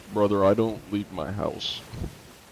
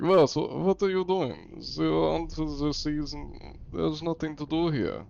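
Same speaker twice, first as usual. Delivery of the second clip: higher-pitched, put-on German accent; hammed-up German complainer, pitched up; 7 semitones higher than usual. German grumbling about silly Americans at sea, comic German accent. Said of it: "borderline doing the German accent".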